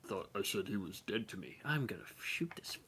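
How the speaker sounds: menacing low mutter. Acted. Villain muttering a threat.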